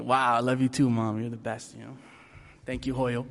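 deadpan mock gratitude to mom; sarcastic thanks. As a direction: thank her without meaning it.

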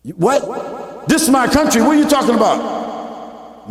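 playing the offended immigrant; indignant surprise. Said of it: "All of a sudden you say".

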